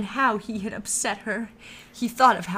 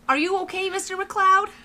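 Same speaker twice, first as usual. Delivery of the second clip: high, loud worried woman; concerned lady; 11 semitones higher, 7 dB louder than usual. Acted. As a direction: ask with real concern, high. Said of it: "concern in her voice".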